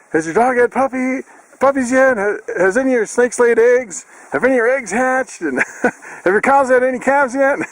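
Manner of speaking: high, worked-up mock questioning; imitated frenzy. Retelling friend's phone call, mock frenzied high voice. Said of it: "he was kinda worked up in a frenzy".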